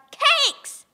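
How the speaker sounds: loud high shout of cakes; high loud baby shout; yelled at top of lungs. Acted. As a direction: bellow it like a demanding baby. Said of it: "at the top of his lungs".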